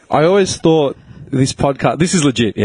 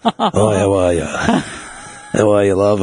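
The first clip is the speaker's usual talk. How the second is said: lightly camp drag queen greeting, low; a hint of a sassy drag queen; 6 semitones lower than usual. Shown as a demonstration. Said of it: "I used to have a drag queen voice".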